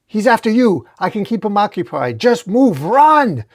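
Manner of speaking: high, loud plea to flee; urgency building to a yell; desperate urging. A narrator's reading. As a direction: shout for them to run.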